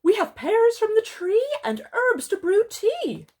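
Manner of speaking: very high, loud storybook bird. Acted. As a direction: pipe it out way up high like a little bird. Storyteller voices Owl, Raven, Wren.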